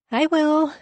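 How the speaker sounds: high, lilting sing-song reply. Acted. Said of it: "I reply in a sing-song voice".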